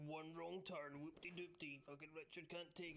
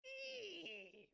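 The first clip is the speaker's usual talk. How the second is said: high squealing eagle cry, half laughing; mock eagle scream; 13 semitones higher than usual. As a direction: screech like a TV eagle. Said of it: "eagle screams you hear on TV".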